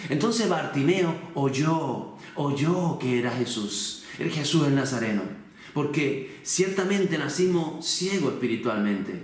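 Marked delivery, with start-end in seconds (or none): none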